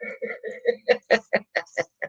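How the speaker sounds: short quiet laugh; amused laugh. Real, not acted. Streamer laughing at a troll's saying, a quiet chuckle.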